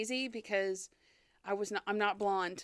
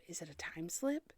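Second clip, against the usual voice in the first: whispered and low; spooky whisper; 4 semitones lower, 6 dB quieter than usual. Pretend. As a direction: hush it like a ghost story. Musing on the paranormal eerily.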